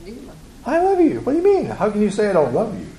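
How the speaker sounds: high, indignant protest; defensive husband. Retold or imitated. Husband answering his wife, high indignant protest. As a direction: act out a husband's hurt, defensive denial. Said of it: "what's his response back".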